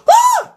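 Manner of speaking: high startled shriek; roach scream. Acted. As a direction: shriek like a bug caught in the light.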